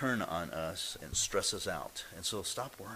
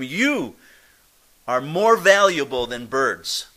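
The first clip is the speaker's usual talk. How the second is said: loud, slow "You" with stress on "more"; emphatic pointing; 3 semitones higher, 13 dB louder, slower than usual. Retold or imitated. Jesus telling his listeners their worth, loud and slow. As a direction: declare it slowly, pointing. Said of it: "he's pointing at them".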